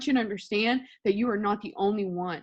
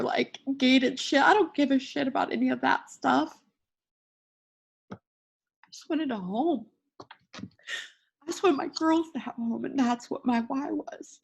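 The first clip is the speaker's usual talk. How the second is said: real crying, voice pitched up; tearful; 3 semitones higher than usual. Real, not acted. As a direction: speak through tears, choked with emotion. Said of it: "bawling my eyes out".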